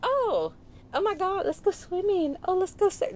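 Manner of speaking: mock Valley girl accent, pitched very high. Friends teasing a Californian with a mock Valley girl accent. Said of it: "they kill me with that Valley girl accent".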